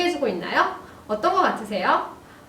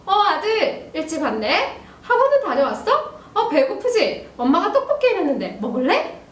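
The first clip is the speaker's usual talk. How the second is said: higher-pitched, chatty mom voice; mom greeting son; 6 semitones higher than usual. Acted. Mom calls to son coming home from school, higher chatty greeting. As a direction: play a mom welcoming her child home. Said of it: "현관으로 들어오는 아들을 보면서 말합니다".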